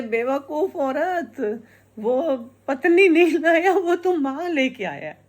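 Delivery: mock-scold the foolish daughter-in-law; hammed-up mother-in-law scolding her daughter-in-law.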